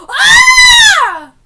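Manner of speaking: high, very loud startled scream; jump scare scream. Real, not acted.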